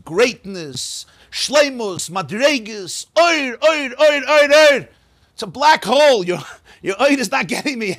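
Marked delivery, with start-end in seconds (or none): 3.2-4.9 louder and higher with each oir; piling up oir, higher and louder each time; building exclamation. Acted. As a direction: chant the word oir up to a near shout. Repeating light, rising to a shout.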